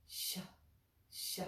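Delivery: a soft hissing sh; sh with the tongue curled back; sh sound. Shown as a demonstration. Teacher showing the Sanskrit sh to students. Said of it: "and it goes like this".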